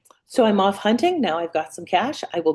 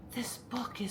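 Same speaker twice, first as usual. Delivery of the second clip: slow breathy whisper; secretive whisper; 3 semitones higher, 12 dB quieter, slower than usual. Retold or imitated. The woman at the thrift store, whispering slowly. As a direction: hush it like a precious secret.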